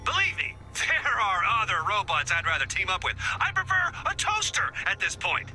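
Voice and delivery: high-pitched, fed-up comeback; exasperated retort. Acted. Exasperated quarrel with robot partner.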